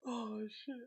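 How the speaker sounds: barely audible, wrung out by laughing; real faint, spent voice after laughing; helpless from laughter. Real, not acted. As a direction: gasp it softly after a laughing fit. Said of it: "You guys are killing me".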